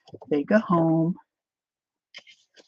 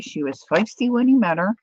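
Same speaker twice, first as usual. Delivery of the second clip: usual speaking voice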